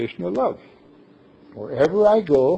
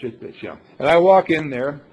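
ordinary delivery

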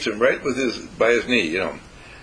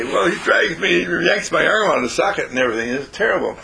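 higher-pitched, worked-up owner's grumble; exasperated complaint; 6 semitones higher, 3 dB louder than usual. Acted. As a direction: complain as a fed-up dog owner. Dog owners complain to trainer.

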